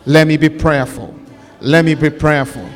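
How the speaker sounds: loud, rhythmic call to God over the music; fervent preaching prayer. Acted. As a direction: pray out at full force, pleading. Leads loud prayer again.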